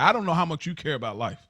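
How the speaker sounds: usual speaking voice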